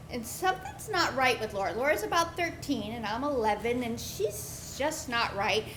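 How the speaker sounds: normal talking voice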